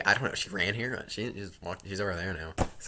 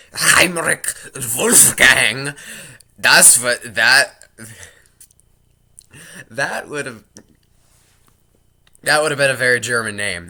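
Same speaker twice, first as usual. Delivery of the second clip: rising emphatic; 5 semitones higher, 11 dB louder than usual. Real, not acted.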